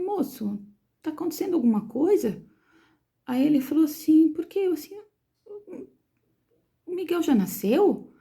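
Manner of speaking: aguda e lenta, temendo a resposta; pergunta aflita. Retold or imitated. Mãe aflita pergunta ao anestesista. (mãe pergunta ao anestesista se o filho nasceu, aflita)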